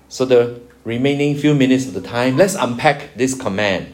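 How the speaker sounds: ordinary talk, nothing marked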